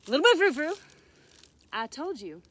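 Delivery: loud scolding call, easing off; scolding fairy. Acted. Good fairy warning the naughty bunny, loud scolding. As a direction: holler the name, then tell him off.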